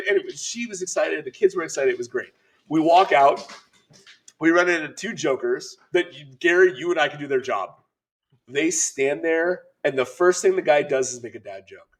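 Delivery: ordinary talk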